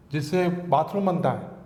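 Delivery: ordinary talk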